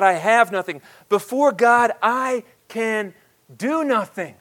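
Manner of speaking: loud, rising sermon climax; full-force preaching. Acted. Pastor drives the point home to the congregation, loud preaching. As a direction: preach it out to the whole room.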